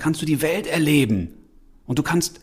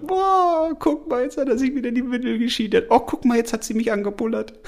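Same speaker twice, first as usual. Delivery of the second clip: imitating besotted parents, voice pitched higher; doting parents cooing; 8 semitones higher than usual. Parents cooing lovingly at their newborn, higher mock baby-adoring voice. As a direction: coo over the baby like a smitten parent. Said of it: "die Eltern gucken dich an und sind total verliebt und sagen".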